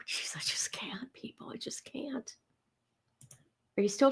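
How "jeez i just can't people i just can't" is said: breathless whisper through laughter. Real, not acted.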